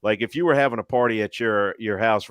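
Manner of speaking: ordinary delivery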